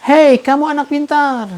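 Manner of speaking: high, loud praise. Retold or imitated. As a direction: shout it with all your might. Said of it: "suara yang lebih keras lagi".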